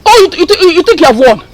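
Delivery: ordinary talk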